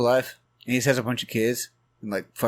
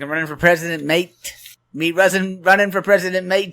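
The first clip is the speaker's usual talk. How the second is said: high, loud mock Irish accent; Conor McGregor; 6 semitones higher, 5 dB louder than usual. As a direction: boast in a hammed-up Irish accent. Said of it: "Irish accent?".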